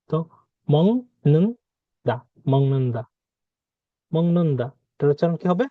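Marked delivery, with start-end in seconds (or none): 0.7-3.0 "মং নুন দা" with ng in place of g; nasalised pronunciation. Shown as a demonstration. Teacher sounding out the ng rule, nasalised pronunciation. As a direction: sound out "মং নুন দা" syllable by syllable, then joined. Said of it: "বাংলায় অনুসারের মতো".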